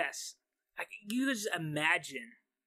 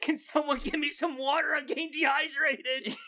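flustered waiter; 8 semitones higher than usual. Acted. Waiter voice continues, half laughing.

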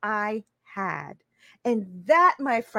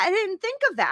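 higher, insistent second take; emphatic repeat; 7 semitones higher, faster than usual. Real, not acted. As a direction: hit DIDN'T higher, stunned by the realization. Repeats the realization twice.

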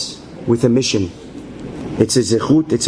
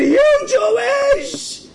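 shocked tribe shout; much slower than usual. Retold or imitated. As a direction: shout it like the whole tribe is stunned. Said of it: "They said".

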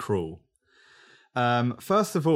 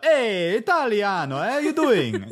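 higher, hammed-up Italian accent; mock Italian accent; 7 semitones higher, 3 dB louder than usual. What one wouldn't say to an Italian, mock Italian accent. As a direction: greet them in a cartoon Italian accent. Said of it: "go up to an Italian".

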